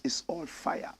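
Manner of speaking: unvoiced soft reading of the comment; whispered read. Host reading a viewer's comment, whispered. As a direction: breathe the comment out.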